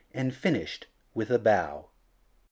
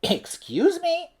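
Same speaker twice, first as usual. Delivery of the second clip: very high, slow small voice; a tiny cricket speaking up to Gerald; little cricket. A narrator's reading. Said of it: "coughed a cricket".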